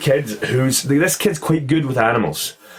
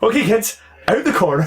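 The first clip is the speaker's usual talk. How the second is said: higher-pitched retold urgency; mock alarmed warning; 8 semitones higher than usual. Sensei moving kids away from a spider, mildly panicked.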